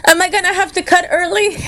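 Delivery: high, fast, laughing through it. Real, not acted. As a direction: giggle the question out. Joking about leaving early.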